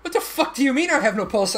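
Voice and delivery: high-pitched incredulous retort; retold disbelief. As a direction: throw it back in disbelief, pitch jumping up. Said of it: "I'm like".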